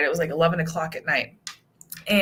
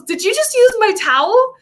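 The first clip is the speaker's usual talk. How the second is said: high, louder startled question; mock shocked husband; 14 semitones higher, 5 dB louder than usual. Wife imitates husband catching her with his towel. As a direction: act his surprise. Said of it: "he goes".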